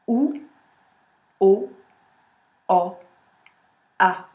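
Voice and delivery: "Ou, o, o, a" spoken slowly, each vowel apart, not sung; spoken vowel drill. Shown as a demonstration. Coach shows the class the vowel line, spoken slowly. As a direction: lay out Ou, o, o, a one by one, relaxed jaw. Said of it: "sans chanter, juste en le disant".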